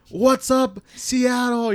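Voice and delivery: high, loud arena shout to the crowd; mock metal frontman. Pretending to be a metal singer, greeting the Seattle crowd loudly. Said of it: "I'm the lead singer of ... metal band".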